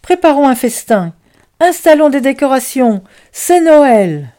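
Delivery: loud, high festive orders; rally everyone with joy; excited shouting. Acted. Boustru urging the children to celebrate, loud and high. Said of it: "cria-t-il".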